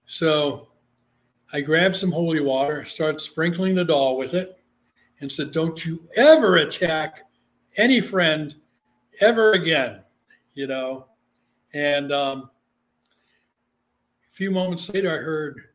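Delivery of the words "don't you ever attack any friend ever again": stern command. Retold or imitated. Sprinkling the haunted doll, he loudly commands the spirit. Scolding the doll with holy water.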